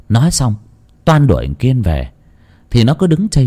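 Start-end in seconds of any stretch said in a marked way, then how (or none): none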